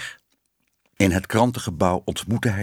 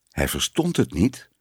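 usual speaking voice

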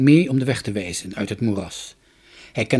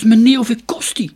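higher, louder, eager question; eager call; 10 semitones higher, 9 dB louder than usual. A narrator's reading. Boy asks the merchant the price, eager. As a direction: ask it hopefully and loud. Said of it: "riep Jorkos".

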